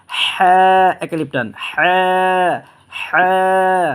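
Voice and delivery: a drawn-out breathy হ্যাঁ like a gasp of surprise; throaty ha. Shown as a demonstration. Teacher to students, a breathy throat হ্যাঁ. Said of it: "এরকমই আপনার গলা দিয়ে বের হবে".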